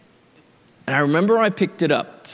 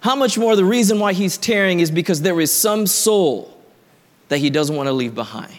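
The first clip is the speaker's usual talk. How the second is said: ordinary talk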